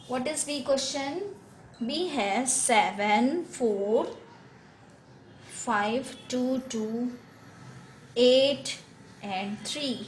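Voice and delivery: reads the question out very slowly, word by word; slow classroom dictation. Shown as a demonstration. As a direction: spell the number out slowly for the class. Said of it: "teacher reading out question".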